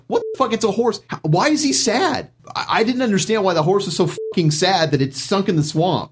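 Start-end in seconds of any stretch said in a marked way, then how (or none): 0.1-2.3 high, baffled disbelief; incredulous. Retold or imitated. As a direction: play it exasperated and confused. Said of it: "I'm like".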